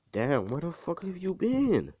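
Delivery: high, startled mom voice; a mom demanding where her son was; mother's high voice. Retold or imitated. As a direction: pitch up as his shocked mom.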